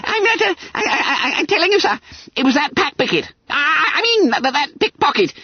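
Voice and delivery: high, stammering squeal; babbling with terror. A narrator's reading. Frightened pupil explaining the pickpocket to schoolmaster, babbling. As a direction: fumble and correct words in a fluster. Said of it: "He was fairly babbling with terror".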